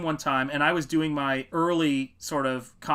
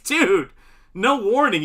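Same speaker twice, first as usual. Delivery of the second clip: shocked protest; 6 semitones higher, 8 dB louder, slower than usual. Retold or imitated. Stunned reaction to the blunt friend.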